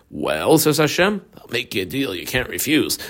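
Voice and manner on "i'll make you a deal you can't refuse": low-key, softly spoken offer; hushed deal-maker's line; quiet deal-making. Acted. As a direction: drop the volume as if making a confidential offer. Voicing Hashem offering Moshe a deal.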